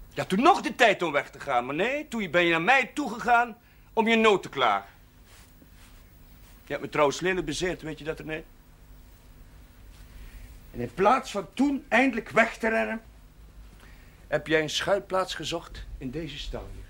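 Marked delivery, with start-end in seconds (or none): 0.1-4.9 loud, high and cutting; angry reproach. Acted. Priest reproaching René for coming to him, loud and angry. 10.7-16.7 heated reproach. Acted. Priest reproaching René.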